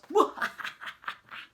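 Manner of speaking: high villain cackle; evil laugh. Pretend. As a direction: cackle like a wicked crocodile. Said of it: "it's an evil laugh".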